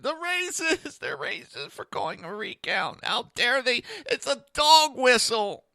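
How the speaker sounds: high, shrill-pitched mock outrage; mock outraged liberal. Parodying outraged liberals, high mock shriek. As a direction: squeal it like an offended activist.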